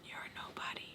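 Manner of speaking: barely audible dismissive whisper; whispered put-down. Retold or imitated. Teller addressing her ex, whispered put-down.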